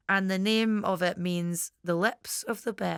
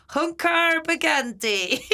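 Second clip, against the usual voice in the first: pirate voice; 8 semitones higher, 4 dB louder, slower than usual. Shown as a demonstration. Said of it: "you sound like a pirate".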